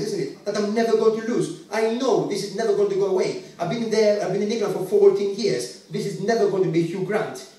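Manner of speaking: normal talking voice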